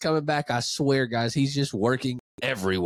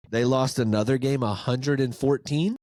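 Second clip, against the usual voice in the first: usual speaking voice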